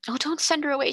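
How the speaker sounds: pleading high and tearful; sobbing plea. A narrator's reading. Girl begging the headmistress not to dismiss the maid, tearful and high. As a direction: beg through tears, voice up high. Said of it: "she sobbed".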